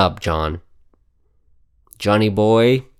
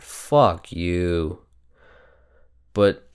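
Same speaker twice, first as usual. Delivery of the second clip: ordinary talk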